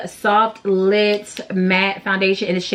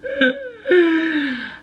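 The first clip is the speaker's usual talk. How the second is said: laugh-cry; 10 semitones higher than usual. Real, not acted. Laughing-crying outburst.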